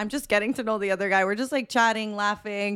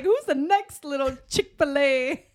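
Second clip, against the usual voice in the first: slow, higher mock prowl with a laugh; playful self-mockery; 4 semitones higher, slower than usual. Dater never settles, eyes the next pick, playful self-mockery. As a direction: draw it out, mocking your own roving eye. Mocks her own roving eye.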